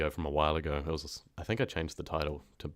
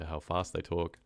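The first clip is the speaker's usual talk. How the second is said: ordinary talk, nothing marked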